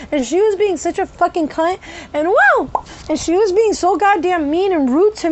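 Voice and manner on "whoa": high yelp; sudden involuntary burst; vocal tic whoa. Real, not acted. Tics still coming out as she talks, high yelp. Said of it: "They were still coming out".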